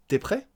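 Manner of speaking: high pitch leaping up on "prêt"; interrogative intonation. Shown as a demonstration. Teacher correcting to spoken French, sharp rise on "prêt". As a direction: ask "T'es prêt" purely with a rising tune. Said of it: "avec une intonation interrogative".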